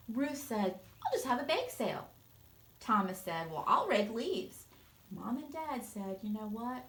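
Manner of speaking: put-on lively read-aloud voice for kids; storybook narration. A narrator's reading. Teacher reading a picture book to her students, expressive narration. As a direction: read it like story time for a class.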